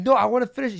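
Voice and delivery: high, loud mock protest; insistent protest. Boyfriend talking over his girlfriend on stream, mock insistent. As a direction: imitate someone refusing to be cut off.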